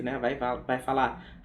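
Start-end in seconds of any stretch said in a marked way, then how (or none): none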